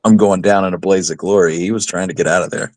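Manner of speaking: ordinary talk, nothing marked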